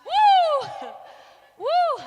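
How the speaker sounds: loud high whoop; excited whoop. Real, not acted. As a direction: whoop to hype the room. Host hyping the crowd.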